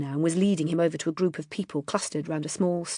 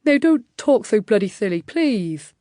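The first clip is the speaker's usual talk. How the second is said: slight lisp; 4 semitones higher, 6 dB louder than usual. A narrator's reading. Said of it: "lisping slightly through his long teeth".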